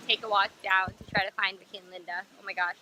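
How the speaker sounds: ordinary delivery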